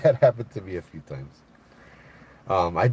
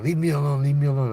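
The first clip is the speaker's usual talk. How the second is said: a louder, higher yelled leave me alone; retold yelling; 7 semitones higher, 4 dB louder than usual. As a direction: yell it back like the homeless man did. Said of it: "starts yelling".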